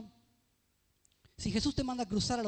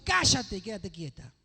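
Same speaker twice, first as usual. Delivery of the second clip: slow, louder stern order; commanding rebuke; 4 dB louder than usual. Acted. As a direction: speak with full authority to the storm. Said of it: "le dijo".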